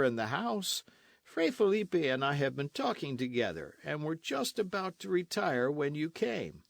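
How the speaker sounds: lightly voiced weary dandy; foppish Don Diego. A narrator's reading. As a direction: play the idle poet-loving nobleman. Don Diego languidly answers.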